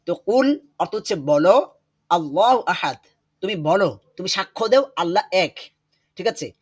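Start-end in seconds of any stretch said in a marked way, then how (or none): none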